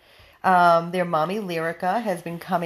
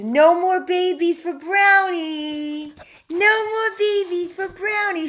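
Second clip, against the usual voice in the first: high singsong; 10 semitones higher, 6 dB louder than usual. Real, not acted. Repeated, higher and louder.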